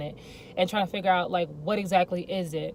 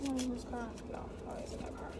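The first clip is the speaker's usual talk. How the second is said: quick hushed aside; whispering; 6 semitones higher, 13 dB quieter, faster than usual. Real, not acted. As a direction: whisper it fast. Hiding from nearby car.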